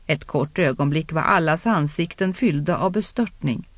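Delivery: ordinary delivery, nothing marked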